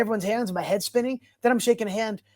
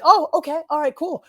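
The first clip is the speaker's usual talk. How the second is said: excited; 9 semitones higher, 5 dB louder than usual. Retold or imitated. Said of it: "I was like".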